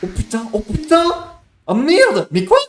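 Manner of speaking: high, loud panicked swearing; real panic, pitch shooting up; panicked shouting. Real, not acted. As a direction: shout it out in shock. Character dies in game.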